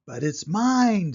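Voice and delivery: loud, slow, indignant cry; the lazy bird claiming the egg back; screamed. A narrator's reading. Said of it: "screamed the bird".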